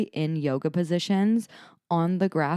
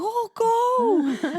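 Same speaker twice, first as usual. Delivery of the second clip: put-on much higher, louder toddler voice; toddler's squeal; 6 dB louder than usual. Mom imitating her toddler as the sprinklers pop up, squealing. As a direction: squeal it like a thrilled little boy. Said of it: "He was like".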